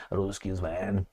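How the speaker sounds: low, hushed role-play. Acted. Mock president talks of the Ruskies, hushed role-play. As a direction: keep it deep and nearly under the breath. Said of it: "This is the same portrayal of J F K with Khrushchev".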